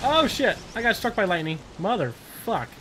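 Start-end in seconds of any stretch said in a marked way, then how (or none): 0.0-0.6 sudden high yelp of surprise; startled curse. Real, not acted. Player struck by lightning, startled yelp. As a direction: blurt it out when hit.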